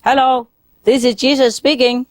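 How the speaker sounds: high-pitched pretend shout, little added volume; hammed-up hail from above; mock loud call. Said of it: "very loud, he said".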